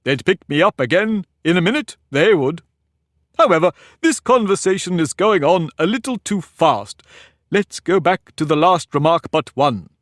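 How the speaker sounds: put-on pompous egg lecturing a girl; haughty egg. Humpty lecturing Alice.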